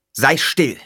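loud, sharp order; stern command. A narrator's reading. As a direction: snap the order. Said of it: "befahl er".

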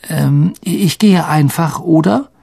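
falter, then end on an unsure question; stammering. Acted. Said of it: "und stammelte". (unsicher stammelnd)

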